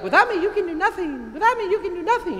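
put-on high, fast little-kid chant with a laugh; a child's sing-song recital; imitating kindergartners. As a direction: mimic small kids reciting by heart. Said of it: "They're like".

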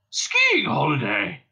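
loud, slow indignant cry; outraged cry. A narrator's reading. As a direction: spit it back in outrage. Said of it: "cried the old man".

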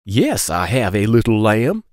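singsong picture-book read-aloud; reading to children. Acted. Storyteller reading a lamb book to kids, singsong. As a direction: lilt the question refrain for little listeners.

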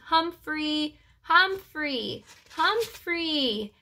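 high, repeated searching calls; calling out. A narrator's reading. Searchers keep calling the lost hamster, calling out. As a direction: draw the name out high, falling at the end. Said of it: "the voices kept calling".

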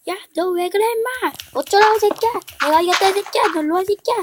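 high, shrill-pitched angry rant; angry friend. Pretend. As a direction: play the furious friend swearing.

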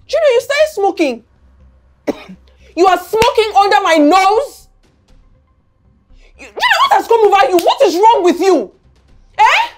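high, incredulous telling-off; outraged scolding. Acted. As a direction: confront your child in shocked anger. Mother catches son smoking, scolds.

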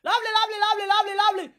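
high, loud excited calling of lovely; mock shout. Host greeting a returnee from abroad, high gushing shout. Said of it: "You just dey shout".

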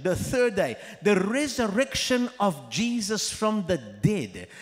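loud, slow, emphatic preacher; preaching at full force. Acted. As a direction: proclaim it to the congregation. Preaching the core message.

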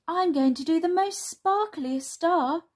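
high, loud, lively announcement; high storybook child voice; excited child character. Acted. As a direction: boast eagerly like a little girl. Voices Sula excited about picture.

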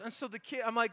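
ordinary talk, nothing marked

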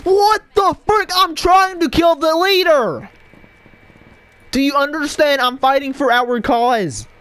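very high, loud, slow outraged pleading; shrieking indignation. Real, not acted. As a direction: squeal that he's fighting for the cause. Mock-heroic outrage at a teammate.

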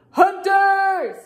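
high, loud alarm cry; yelling. A narrator's reading. Moose spotting the squirt guns, yelled alarm. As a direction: sound the alarm at full voice. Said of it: "and yelled".

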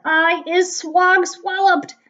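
high, slow, booming bellow; bellowing giant. A narrator's reading. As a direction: bellow it out word by word. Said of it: "bellowed the bun cr".

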